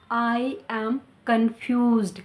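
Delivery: very slow, confused with a clear ed; slow correct take. Shown as a demonstration. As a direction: say it slowly with the ending. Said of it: "हम इसे ऐसे नहीं बोलेंगे".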